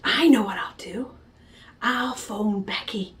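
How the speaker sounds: unvoiced scheming whisper. Girl secretly plotting, whispered. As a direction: whisper the plan to yourself.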